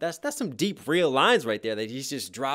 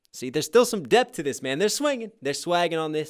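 higher and louder, hyped up; buzzing with excitement; excited; 5 semitones higher, 6 dB louder than usual. Real, not acted. Hyped praise of the bars.

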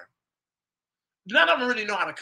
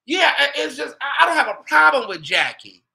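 emphatic protest; 8 semitones higher, 6 dB louder than usual. Real, not acted. Insisting no problem with Jackie.

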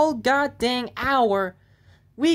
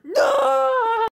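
high, loud cry of no; despairing shout; 13 semitones higher, 4 dB louder than usual. Acted. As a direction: wail it out in despair. Reacting to being pushed back.

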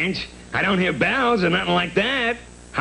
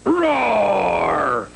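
beast roar. Acted. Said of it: "with a mighty roar".

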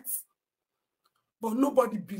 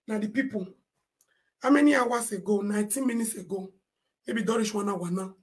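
ordinary delivery

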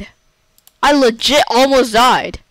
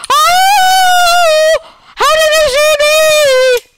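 high, loud startled cry; panicked shout; 6 dB louder, much slower than usual. Real, not acted. Gamer yelps when the mobs spot him. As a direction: yell in sudden panic.

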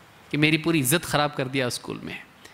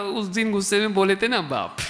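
put-on diplomatic child; 3 semitones higher than usual. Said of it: "safe and secure diplomatically बोलेगा वो". (बेटा अबू को diplomatic अंदाज़ में जवाब देता हुआ)

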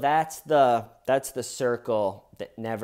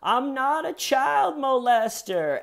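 much higher, slow squeaky cartoon voice; Mickey Mouse voice; 4 dB louder, slower than usual. Jeff Stacy denying to the detective, Mickey Mouse voice. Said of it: "in the perfect Mickey Mouse voice".

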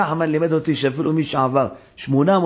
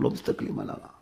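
whispered plea; 11 dB quieter than usual. Acted. Abraham quoted to God.

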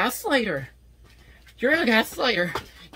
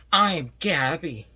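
usual speaking voice

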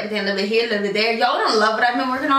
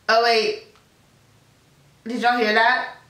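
ordinary talk, nothing marked

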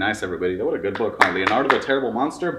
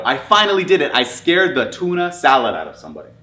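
loud, high and exultant; triumphant cheer; 4 semitones higher, 7 dB louder than usual. A narrator's reading. Monster who finally scared somebody, cheering. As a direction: crow over a first success. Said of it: "cheered Leonardo".

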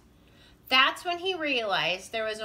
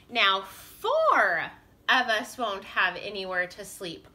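usual speaking voice

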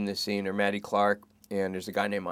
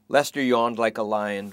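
ordinary delivery, nothing marked

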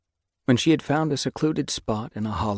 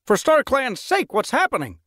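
exclaiming; 6 dB louder than usual. A narrator's reading. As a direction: cry out in alarm. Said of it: "Finchflight exclaimed".

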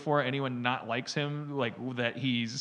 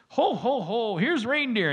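voicing dog; 7 semitones higher, 3 dB louder than usual. Dog Harvey voiced watching TV.

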